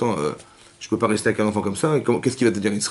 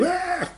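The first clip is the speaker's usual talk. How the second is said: high, sudden shriek at a mouse; demo scream; 4 dB quieter than usual. Shown as a demonstration. Teacher shows the class a girl's high shriek. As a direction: shriek as if a mouse just ran by. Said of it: "Vous voyez une souris?".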